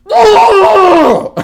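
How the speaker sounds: loud, high mock wail of baby outrage; pissed-off baby scream. Dad mimicking his baby daughter who can't grab the ducks, pissed-off scream. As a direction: imitate an infant's furious shriek. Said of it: "I'm pissed off because I can't touch 'em".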